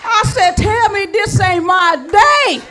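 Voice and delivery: high, loud, laughing disbelief; overjoyed laughing cry; ecstatic. Retold or imitated. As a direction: shout the joke with glee.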